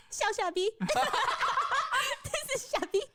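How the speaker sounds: amused reveal; laughing. Real, not acted. Host laughs presenting the last meme, laughing.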